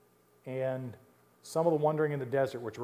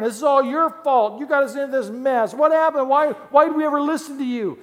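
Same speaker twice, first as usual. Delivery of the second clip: mock accusing rant; 11 semitones higher, 11 dB louder than usual. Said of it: "they cursed Moses and Aaron".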